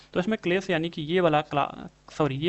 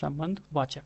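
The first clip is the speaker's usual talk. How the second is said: slow soft repeat; 2 semitones lower, 6 dB quieter, slower than usual. Shown as a demonstration. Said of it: "तो संबंधवाचक होता है".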